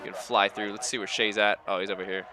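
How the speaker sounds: usual speaking voice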